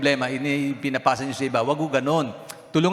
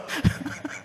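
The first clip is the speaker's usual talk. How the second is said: high breathy laugh. Real, not acted. Laughter mid-story.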